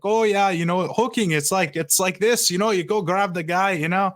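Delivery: high, hammed-up Canadian accent. Goalie explaining penalties to an interviewer, imitated Canadian accent. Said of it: "just the Canadian accent".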